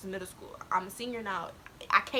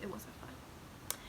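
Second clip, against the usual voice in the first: real sadness fading into a whisper; subdued near-whisper; 4 semitones lower, 18 dB quieter, much slower than usual. Real, not acted. Vlogger admits hard time, subdued whisper. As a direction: voice dropped low and very soft, slow. Tearful admission of depression.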